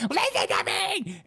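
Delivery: very high-pitched, strained mock scream, drawn out. Host imitating people screaming for their lives, mock scream. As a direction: imitate a desperate protester's squealing cry. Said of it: "people are screaming for their lives and screaming".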